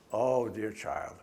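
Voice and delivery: ordinary talk, nothing marked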